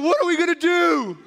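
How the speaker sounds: high, loud, frantic question; a father losing it completely; panicked. Retold or imitated. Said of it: "my reaction when I saw that was to scream".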